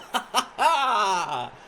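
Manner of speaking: happy laughter after goal; delighted laugh. Real, not acted. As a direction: laugh out with joy.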